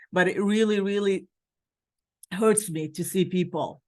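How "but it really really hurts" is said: emotional, voice catching. Real, not acted. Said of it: "not to get emotional".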